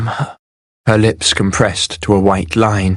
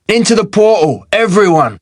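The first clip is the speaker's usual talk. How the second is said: rally everyone with a raised voice; 10 semitones higher than usual. A narrator's reading. Agur calls the crowd into the portal, voice raised high. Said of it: "He raised his voice".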